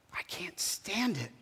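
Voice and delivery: whispered through resentment; bitter whisper. Acted. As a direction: hiss it as a seething aside. Jonah's bitter aside.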